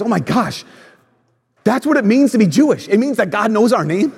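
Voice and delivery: excited realization. Retold or imitated. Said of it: "they realize".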